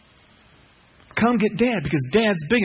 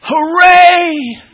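shouted, much higher joyful hooray; hooray cry; 13 semitones higher, 14 dB louder than usual. Retold or imitated. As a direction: cheer it out at the top of the voice. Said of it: "finally they say".

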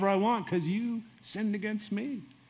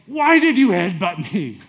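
a sudden loud, angry yell at the bully; 2 semitones higher, 10 dB louder than usual. Retold or imitated. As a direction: shout it in anger, retelling the headbutt. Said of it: "I yelled at him in anger".

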